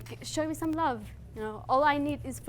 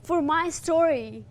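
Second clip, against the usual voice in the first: poem recitation; 2 semitones higher, 5 dB louder than usual. Acted. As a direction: declaim it like a lament for a lost homeland.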